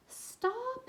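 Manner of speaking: put-on high, sharp child's shout. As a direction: cry out to stop them. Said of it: "Hazel shouted".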